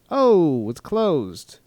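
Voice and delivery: mock dismay. Acting out finding restroom closed.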